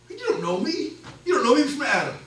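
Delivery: high, loud, laughing mock protest; defensive outburst. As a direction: bristle like a man caught off guard. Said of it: "Nathanael immediately becomes defensive".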